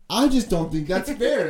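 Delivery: high, loud, drawn-out imitation of a complaint; exaggerated aggrieved outburst; mock aggrieved. Imitates cleaner's outburst after a pause.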